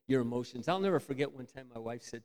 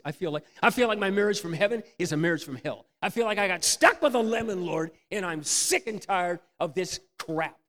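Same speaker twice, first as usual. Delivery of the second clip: venting complaint; 5 semitones higher, 6 dB louder than usual. Retold or imitated. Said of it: "I just said".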